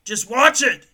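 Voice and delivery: loud, high put-on shouting; mock frantic command. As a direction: order them back in a hammed-up yell. Acting out frantic soap man.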